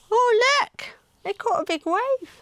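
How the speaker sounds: high, bright exclamation; excited. Acted. Telling the children excitedly about the big wave. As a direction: point at the wave, thrilled.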